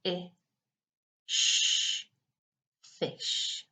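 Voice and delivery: F, I, sh, then blend fish; sounding out words. Shown as a demonstration. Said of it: "it should look like this".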